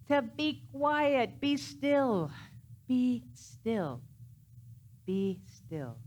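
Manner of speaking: high, slow commands to the thoughts; commanding be still. Shown as a demonstration. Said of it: "Sometimes you have to get really emphatic".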